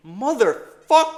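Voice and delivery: a loud, slow swear at the chart; real frustration bursting out; frustrated yell. Real, not acted. Trader cursing his chart, frustrated yell.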